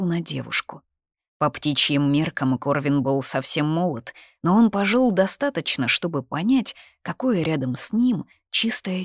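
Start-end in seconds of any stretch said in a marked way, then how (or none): none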